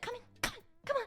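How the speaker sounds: high, soft come-hither call from a window; beckoning psychic. Retold or imitated. Psychic calling the passer-by in, soft and high.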